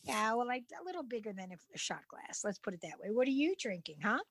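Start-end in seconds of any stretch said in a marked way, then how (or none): none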